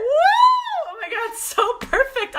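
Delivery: huge high whoop, then high thrilled words; excited shout. Real, not acted. Noticing she started at three thirty-three, whooping. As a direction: shout for joy at the sign. Number coincidence delights her.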